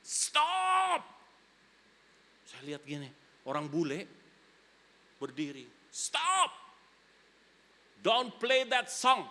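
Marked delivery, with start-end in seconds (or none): 0.0-1.0 high sharp mock yell of stop; retold shout. Foreigner yelling at the pianist, high mock shout. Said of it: "Dia teriak". 5.9-9.2 high, slow angry English yell; shouting foreigner. Retold or imitated. Angry foreigner yelling at the piano player to stop. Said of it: "dia teriak".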